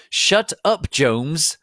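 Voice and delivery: very high, very loud yell; shouting. A narrator's reading. Draco's dad shouting at Jones to shut up, very loud. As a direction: bark it to silence him. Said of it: "Draco's dad shouted".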